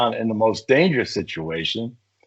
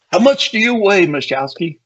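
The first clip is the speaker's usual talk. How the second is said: much higher and louder, a barking officer; captain's booming question; 11 semitones higher, 4 dB louder than usual. Retold or imitated. Captain asks the tiny officer his weight, booming. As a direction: boom it like a commander sizing him up.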